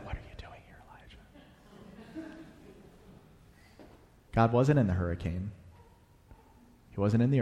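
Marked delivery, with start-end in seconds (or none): 0.1-2.3 breathy whisper of God. Shown as a demonstration. Pastor shows God's question as a thin whisper. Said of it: "the voice probably said".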